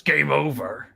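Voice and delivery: lightly voiced overlay announcer; slightly put-on game announcer voice; game-over announcement. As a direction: announce it like a sound played through the house. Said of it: "then you hear overlay sound in the whole house".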